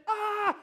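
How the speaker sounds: high, loud imitated cheer; imitated roar of a celebrating crowd; short high "ah" of triumph.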